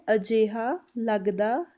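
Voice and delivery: अजिहा लगदा है said slowly, syllable by syllable; very slow, spelled out. Shown as a demonstration. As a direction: drag each word out. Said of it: "इसको पंजाबी में बोला जाता है".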